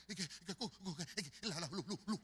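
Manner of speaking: imitating a tongue-tied, stuttering churchgoer; soft, halting stutter on broken syllables; panicked stammer. Flustered church sister reporting to the pastor, stammering. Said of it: "¿Me está hablando lenguas".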